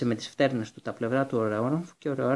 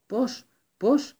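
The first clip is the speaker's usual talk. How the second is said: much higher, louder, halting disbelief; stammered in shock; 10 semitones higher, 5 dB louder than usual. A narrator's reading. Astonished stammer at Harry's arrival. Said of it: "ψέλισε ο Μπλακ".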